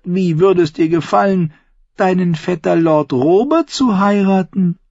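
high, loud, ranting Lysa; bitter aunt. A narrator's reading. Aunt Lysa rants to Sansa about Tyrion, high and heated. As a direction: rail against the dwarf, spiteful.

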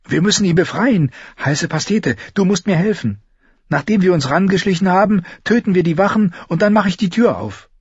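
high, urgent character voice; high-pitched, insistent plotting; urgent young Arya. A narrator's reading. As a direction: voice Arya pressing a friend for help.

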